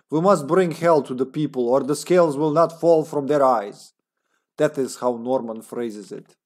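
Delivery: put-on comrade Russian accent. Agent's journal about a final plan, Russian accent. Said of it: "his grammar is Russianish".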